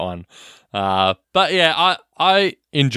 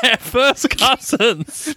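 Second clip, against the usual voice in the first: much higher, slow, drawn-out teasing; mock high teasing voice; 13 semitones higher, much slower than usual. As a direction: stretch it out in a silly high voice. Teasing reply in a put-on voice.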